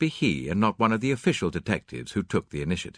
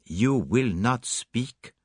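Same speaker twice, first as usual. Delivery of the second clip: slow question; 2 semitones higher, much slower than usual. Acted. Poirot asks slowly.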